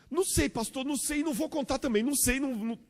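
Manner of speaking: put-on very high, loud child's voice; a second child refuses to answer the pastor, very high and rushed; nervous child. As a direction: squeak it out like a little kid dodging the question. Second child dodges the pastor's question.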